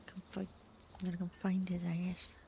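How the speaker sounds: whispered threat; whispering. Real, not acted. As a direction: whisper it like a plotter. Threatening aside.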